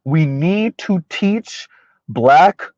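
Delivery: very slow, spaced-out words; slow and deliberate. Real, not acted. Host restating the caller's words, very slow and deliberate. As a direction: stretch each word out deliberately. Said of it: "gonna talk slow so I can be very deliberate".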